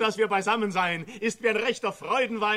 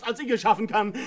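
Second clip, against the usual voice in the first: usual speaking voice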